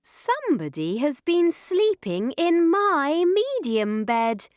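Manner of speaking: story-time mama bear, swooping upward; middle-sized Mummy Bear voice. A narrator's reading. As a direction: pitch it up with indignation. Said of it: "in her middle-sized voice".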